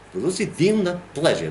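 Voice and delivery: "velocity, pleasure" as Russian, pitch peaking high then dropping; Russian rhythm. Shown as a demonstration. Teacher shows how Russian sounds, peak and trough on "velocity, pleasure". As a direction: lift "velocity" high, let "pleasure" fall. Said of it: "like that".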